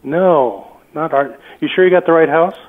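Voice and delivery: usual speaking voice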